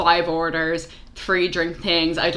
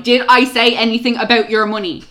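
louder, higher exasperated comeback; indignant retort; 5 semitones higher, 7 dB louder than usual. Retold or imitated. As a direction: challenge the customer, acting out indignation. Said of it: "immediately on the defensive".

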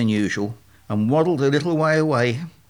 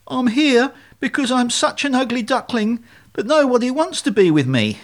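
high, loud-edged duckling whine; sniffly self-pity; 11 semitones higher, 3 dB louder than usual. Acted. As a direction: sniffle it out, feeling sorry for yourself. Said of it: "he sniffed".

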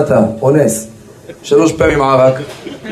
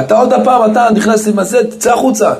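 high, indignant scolding; scolding beadle; 8 semitones higher than usual. Retold or imitated. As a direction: throw the pest out with pitched-up outrage. Beadle chasing the thief out. (הגבאי גוער בגנב בקול גבוה)

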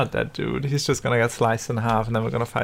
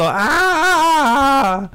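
battle cry; 12 semitones higher, 4 dB louder than usual. Acted. Character cries out in fight.

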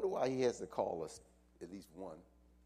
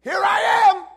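much higher, louder cry; shouted answer to God; 25 dB louder than usual. Shown as a demonstration. Pastor showing the church how to answer God, loud shout. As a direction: shout it back like you mean it. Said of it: "you better respond".

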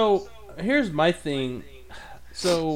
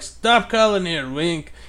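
annoyed newspaper clerk; 5 semitones higher, 6 dB louder, slower than usual. Retold or imitated. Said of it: "And like".

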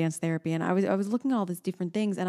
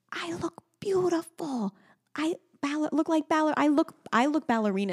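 put-on whispery toddler voice; 9 semitones higher than usual. Mother imitating her daughter spinning in a dress. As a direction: imitate a proud little girl spinning. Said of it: "She goes".

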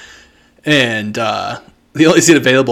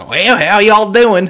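slow, high Southern drawl; Southern accent; 7 semitones higher, slower than usual. Retold or imitated. Mocking Midwest locals, a slow Southern accent. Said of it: "speak like they're from the panhandle".

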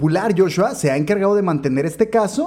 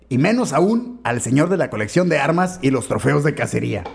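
ordinary talk, nothing marked

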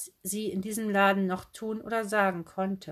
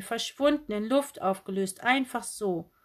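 ordinary delivery

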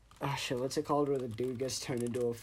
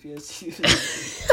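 laughing; 4 semitones higher, 7 dB louder, slower than usual. Real, not acted. Teasing friend laughs mid-line.

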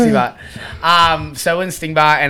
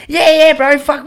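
high, loud, keen agreement; eager agreement; 13 semitones higher, 7 dB louder than usual. Retold or imitated. Retelling his reply to the manager, eager agreement. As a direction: agree loudly like an excited mate.

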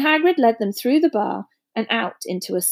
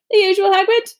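barman voice; 10 semitones higher, 6 dB louder than usual. Acted.

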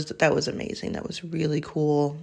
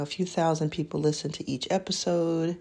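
ordinary talk

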